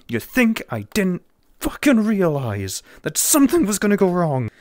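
loud, high, indignant rant; hammed-up outrage, voice leaping up; mock outraged. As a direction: berate them in loud mock disbelief.

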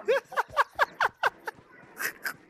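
loud high-pitched laugh. Real, not acted. Laughs at teasing.

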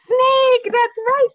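high, loud burst of praise; excited praise. Real, not acted. Teacher cheering the children for guessing the snake, excited. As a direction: cheer the right answer.

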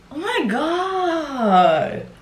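high, long drawn-out exclamation; mock shock. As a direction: stretch it out in hammed-up disbelief. Acted overblown reaction to story.

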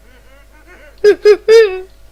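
bursting, loud laugh. Real, not acted. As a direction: let out a big, loud laugh. Loud laugh at excited pet. (głośny wybuch śmiechu)

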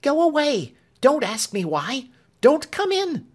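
high, loud, panicked woman's voice; a frightened servant shooing him away; frantic maid. Acted. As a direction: beg him off in a rising panic. Clemency frantic, pushing him back.

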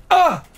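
high, loud staged dying yelp, second take; fake death cry. Streamer retries the fake death, loud yelp. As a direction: cry out as if struck down again. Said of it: "Hang on".

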